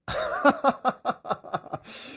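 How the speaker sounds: high staccato laugh; real chuckle in a quick run. Real, not acted. Host amused by the pronunciation muddle, laughing.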